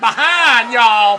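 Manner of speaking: very loud, high parody twang; mock Southern accent. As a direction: blare it like a hammed-up Southern politician. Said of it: "A O C doing the same thing".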